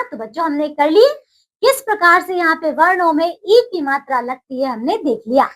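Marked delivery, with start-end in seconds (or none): none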